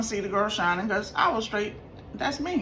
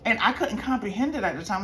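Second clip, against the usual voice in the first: ordinary delivery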